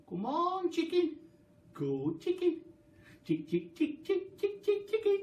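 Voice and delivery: high, sing-song coaxing; coaxing call. Acted. George crouching to coax the chicken, high sing-song. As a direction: sweetly call the chicken over.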